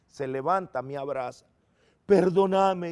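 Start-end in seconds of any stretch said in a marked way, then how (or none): 2.1-2.9 pleading apology. Retold or imitated. Father begging son's forgiveness.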